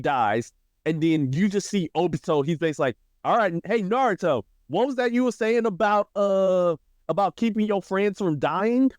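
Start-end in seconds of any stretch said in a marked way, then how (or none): 3.2-8.9 higher-pitched, needling fake question; mock taunting. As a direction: taunt them in a raised, goading voice. Acts Obito taunting Naruto over deaths.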